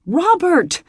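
high, loud desperate cry; desperate call. Acted. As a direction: call after him in panic. Beth crying after him.

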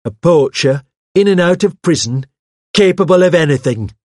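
high, loud, heated accusation; indignant denunciation. Acted. Hawes argues the poacher's guilt to the vicar, heatedly. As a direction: hammer home each damning point. Hawes goes on denouncing Archer.

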